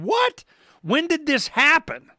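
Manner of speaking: slow, stunned question; incredulous. Real, not acted. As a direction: ask it like you can't believe nobody checked. Disbelief at forgotten origins.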